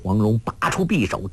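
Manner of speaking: ordinary delivery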